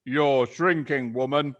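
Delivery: gloating Mister Twit. Acted. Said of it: "said Mister Twit".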